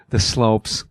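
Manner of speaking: lightly voiced Walken, slow and clipped; a hint of Christopher Walken's halting delivery; Walken impression. Telling a co-star on set, lightly imitating Walken. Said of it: "and he goes".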